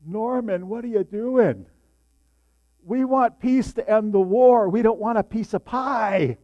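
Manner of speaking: a hint of a puzzled teenage friend; mildly incredulous friend. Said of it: "he goes".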